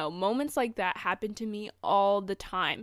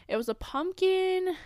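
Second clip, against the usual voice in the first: "pumpkin" lifted much higher and stretched slowly; drawn-out high pumpkin; 11 semitones higher than usual. Shown as a demonstration. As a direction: pull "pumpkin" up high and slow, savouring it.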